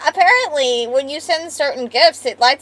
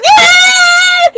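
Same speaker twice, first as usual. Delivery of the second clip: high, loud shrieking yelp; hammed-up high scream; mock scream; 7 dB louder than usual. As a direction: shriek like you're being swung. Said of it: "And you just go".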